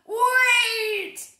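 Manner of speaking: high loud shout. Acted. Mister Brown shouting after the schoolchildren. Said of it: "shouted Mister Brown".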